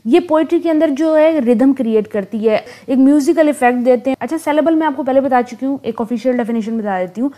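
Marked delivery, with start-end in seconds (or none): none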